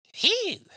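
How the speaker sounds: high falsetto "hee"; short, very high-pitched squeal of appreciation; admiring whoop. Real, not acted. Husband admiring his dressed-up wife, high falsetto whoop.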